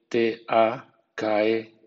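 each letter spelled slowly and apart; spelling out letters. Shown as a demonstration. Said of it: "o bien". (deletreo lento)

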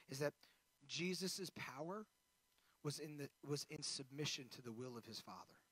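ordinary delivery

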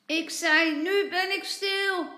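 put-on high, exasperated child's shout; loud shout. A narrator's reading. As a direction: shout it out clearly to be understood. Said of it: "dan roept hij hard".

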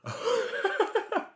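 high-pitched laugh; short giggle. Real, not acted. Laughs at eclipse joke.

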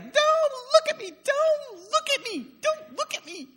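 put-on very high, loud little-kid wail; shrieking toddler. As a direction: imitate a small child's tantrum, squealing each repeat. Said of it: "she was like".

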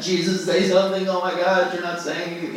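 high-pitched mock desperation; exasperated pleading. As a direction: plead in a high, frustrated rush. Acting out his pleading frustration.

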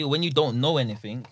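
normal talking voice

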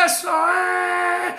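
high, drawn-out rallying cry; exultant, sustained preacher's shout; celebrating shout. Real, not acted. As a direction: whip the congregation up, holding each vowel. Whipping congregation into celebration.

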